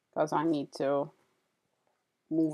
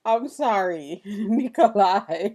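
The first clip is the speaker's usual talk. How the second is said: laughing apology, louder; laughing after catching herself yelling on stream; 3 semitones higher, 7 dB louder, slower than usual. Real, not acted. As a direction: giggle through the sorry and the name.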